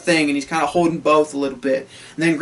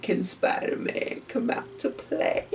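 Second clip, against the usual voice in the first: raspy villain whisper over the phone; Green Goblin; 4 semitones higher, 10 dB quieter, slower than usual. Retold or imitated. Villain taunting Peter on the phone, menacing whisper. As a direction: creep him out, breathy and slow. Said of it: "Green Goblin being like".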